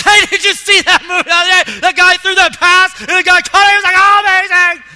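very loud, high, excited chatter. Retold or imitated. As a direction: shout the story across the room with glee. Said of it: "you'll hear louder talking".

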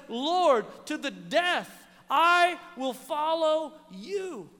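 retold solemn pledge, slowing right down; emphatic vow. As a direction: proclaim a vow of loyalty, drawing out the last words. Peter's vow to follow Jesus.

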